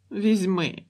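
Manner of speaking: put-on high little-girl pout; pouting child. A narrator's reading. Girl gives up the ball to her sister, pouting. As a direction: give in sulkily, lips pushed out. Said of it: "надула губки дівчинка".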